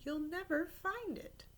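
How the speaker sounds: mild warning from grandmother. Acted. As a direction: caution the boy gently. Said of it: "she warned".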